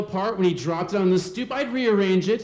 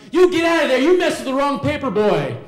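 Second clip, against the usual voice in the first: much higher and louder, a bully's threat; furious yell; 6 semitones higher, 6 dB louder, faster than usual. Retold or imitated. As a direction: roar at the kid hiding in the igloo. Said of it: "he's like".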